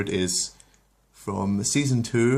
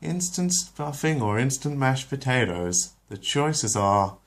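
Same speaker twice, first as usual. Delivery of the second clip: mild Lois voice; 6 semitones higher, slower than usual. Acted. Lois dithering over potatoes.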